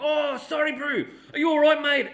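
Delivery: high, cheery Kiwi accent, self-mocking; imitating a friendly Kiwi, a bit overdone; New Zealand accent. Friendly Kiwi apologising for a convention bump, mock New Zealand accent. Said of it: "I can't do a proper New Zealand accent".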